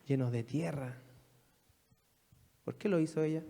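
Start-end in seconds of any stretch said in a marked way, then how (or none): 0.1-1.0 "llenos de tierra" said softer and lower with scorn; hushed disdainful Pharisee; scornful mock pity. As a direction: sneer "llenos de tierra" quietly as Simon. Simon mocks the woman.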